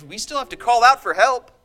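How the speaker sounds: high, loud, fiery sermon climax; loud, high, impassioned pulpit voice; full-force preaching. Acted. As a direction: preach it out with rising passion.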